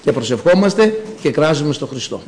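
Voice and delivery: usual speaking voice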